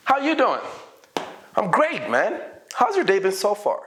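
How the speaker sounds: high-pitched, lively small-talk exchange; cheerful role-play. Shown as a demonstration. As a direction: greet and answer with an upbeat lift. Acts out cheerful practice dialogue.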